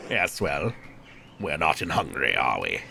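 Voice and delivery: low, soft monk's voice; deep, restrained churchman; guarded prior. Acted.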